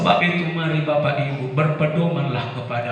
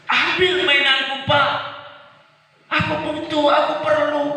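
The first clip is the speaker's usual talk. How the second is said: put-on high whiny child begging; child begging father for his toy; crying child. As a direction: plead like a small kid in tears. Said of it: "Dia menangis".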